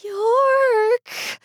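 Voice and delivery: piercing high scream of York; shriek. A narrator's reading. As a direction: scream his name in terror. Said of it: "she shrieked".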